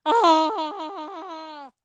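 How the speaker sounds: high, loud "Ah!" as a joke; mock scream. Streamer voicing inner panic over doing fine, mock scream. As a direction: let out a short playful scream. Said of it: "I'm internally screaming".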